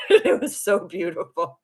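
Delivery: real laughter through the words; laughing. Real, not acted. Streamer recalling her quarrel with spirit guides, laughing. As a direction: say it while laughing. Laughing after own acted tantrum.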